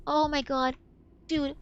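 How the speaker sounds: ordinary talk, nothing marked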